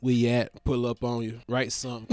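low, soft, laid-back hustler voice; a friend drops his voice to pitch a money deal; imitating the caller.

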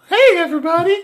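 very high mock falsetto; silly put-on voice. Shown as a demonstration. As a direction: demonstrate an absurdly high fake phone voice. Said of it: "calling you and talking like this".

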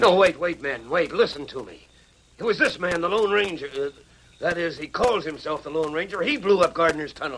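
high, frantic begging; panicked pleading. Acted. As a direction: scramble to save yourself. Villain begging the angry miners.

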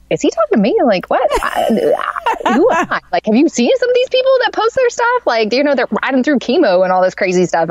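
high-pitched, incredulous "who, me?"; retold disbelief. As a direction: act out baffled modesty, pitch jumping up. Retold disbelief at being called inspirational.

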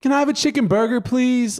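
high-pitched polite order; fake proper voice. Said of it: "trying to be proper".

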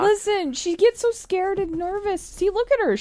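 high pup-talk voice. Real, not acted. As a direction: talk way up high to soothe the dog. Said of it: "I have to talk in baby voice".

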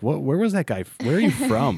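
confused, rising high and loud; puzzled high voice. Retold or imitated. Strangers baffled by his hybrid accent, high puzzled squeal. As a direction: squeal it up in disbelief. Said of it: "they would be like".

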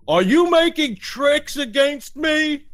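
very high, loud, drawn-out giant; suspicious Cyclops. Acted. Cyclops challenging the players over tricks, suspicious. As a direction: accuse slowly like a huge simple monster. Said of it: "the Cyclops looks at you and says".

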